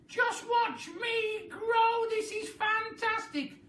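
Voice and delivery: very high, loud cackling crone voice; a mad old woman crowing in triumph; Grandma crowing as she grows, shrill glee; wild old grandma. A narrator's reading. Grandma character voice.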